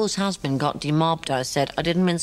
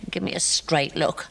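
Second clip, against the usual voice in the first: plain reading voice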